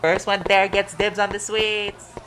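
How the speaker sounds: a gleeful challenge shouted to a friend; excited shout. Acted. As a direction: yell it as a dare to race. Calls out a race to food.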